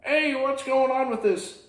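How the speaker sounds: high pissed-off witch voice; angry witch. Retold or imitated. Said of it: "She says".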